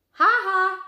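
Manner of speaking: high, loud mocking laugh-back. A narrator's reading. Annie mocking the laughing hyenas. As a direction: throw their laugh back at them.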